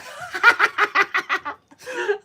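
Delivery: high, loud cackle; long peal of real laughter; big laugh. Real, not acted. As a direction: crack up loudly, high and rapid. Laughing after chat message.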